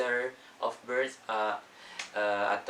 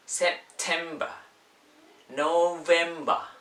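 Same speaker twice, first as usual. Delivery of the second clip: British accent, "-ber" said as "-ba" without the r; slow, higher British month names; British pronunciation; 6 semitones higher, 5 dB louder, slower than usual. Shown as a demonstration. Teacher showing the British difference, British accent. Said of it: "di British".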